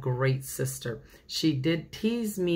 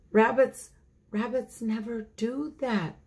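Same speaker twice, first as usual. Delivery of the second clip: sleepy rabbit; 7 semitones higher than usual. Acted. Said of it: "said the sleepy little rabbit".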